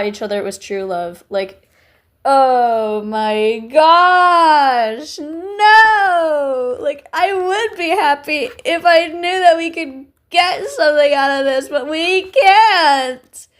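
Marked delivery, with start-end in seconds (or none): 2.2-13.5 high, loud, drawn-out fangirl wail; hammed-up anguished wailing; melodramatic despair. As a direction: wail it out, stretching the big words. Mock despair over impossible fictional couple.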